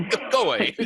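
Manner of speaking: laughing brush-off. Retold or imitated.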